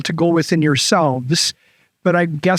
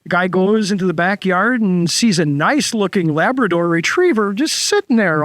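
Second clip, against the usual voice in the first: ordinary talk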